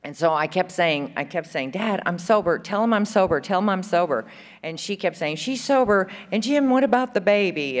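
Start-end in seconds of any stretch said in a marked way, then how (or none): 1.7-4.2 high, insistent begging; urgent reenacted protest; retold pleading. Keeps begging dad from jail. 5.3-7.8 high, protesting imitation; raised-pitch, insistent appeal; retold pleading. Argues with dad on caller's behalf.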